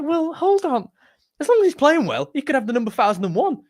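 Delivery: high-pitched, comic disbelief; mock exasperation. Mock-indignant reply to fans' fuss.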